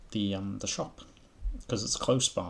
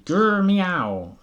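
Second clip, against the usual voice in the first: high, loud, vexed growling meow; angry grr meow from the cat; 10 semitones higher, 12 dB louder than usual. A narrator's reading. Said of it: "with an angry".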